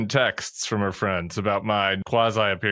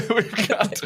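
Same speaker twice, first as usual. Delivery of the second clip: laughing; 14 semitones higher, 3 dB louder than usual. Real, not acted. Restart while laughing.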